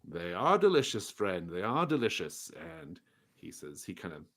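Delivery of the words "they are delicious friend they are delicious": higher, louder, eager salesman voice; beaming merchant insisting on his pies. Acted. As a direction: press the praise like a proud baker.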